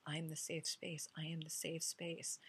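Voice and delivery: soft whispered affirmation; whispering a mala-bead mantra. Shown as a demonstration. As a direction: murmur it to yourself over the beads. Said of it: "I'll say that with my malas".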